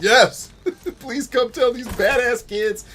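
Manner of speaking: gleeful cheer, then a laughing plea; eager administrators. Retold or imitated. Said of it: "probably like".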